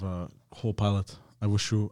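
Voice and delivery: ordinary talk, nothing marked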